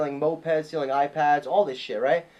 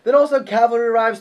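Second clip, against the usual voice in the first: ordinary delivery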